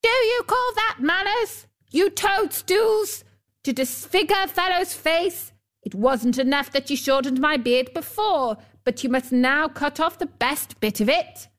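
high-pitched scolding dwarf; indignant yell. A narrator's reading. Dwarf berating the girls who cut his beard, indignant yell. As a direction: start high and affronted, easing slightly as the complaint runs on. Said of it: "He yelled to them".

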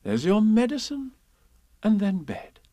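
high, singsong instruction; cheerfully firm mother voice; motherly Kanga. Acted. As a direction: lilt it to a child. Kanga announces medicine.

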